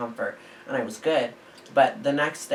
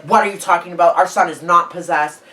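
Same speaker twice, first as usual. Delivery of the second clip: loud, higher protest; parents yelling; 4 semitones higher, 9 dB louder than usual. Retold or imitated. Parents yelling at the cloaked man, loud and higher. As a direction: shout back in disbelief. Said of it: "like, yelling".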